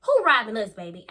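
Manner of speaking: loud incredulous burst; loud disbelief. Retold or imitated. Texting the relative about the break-in, loud disbelief. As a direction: throw the question out in outrage.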